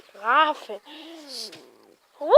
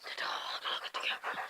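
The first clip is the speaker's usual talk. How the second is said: soft unvoiced whisper; 20 dB quieter, faster than usual. Real, not acted. Whispering to the dog to look at the camera. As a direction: hush it like a secret.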